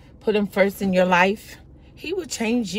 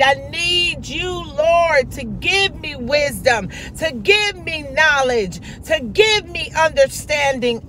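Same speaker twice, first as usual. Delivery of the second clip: fervent, higher cries of I need you, Lord; building prayer; 8 semitones higher, slower than usual. Acted. Preacher leading the congregation in prayer, fervent pleading cry.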